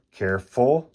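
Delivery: ordinary delivery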